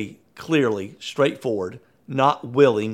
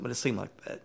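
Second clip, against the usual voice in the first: ordinary talk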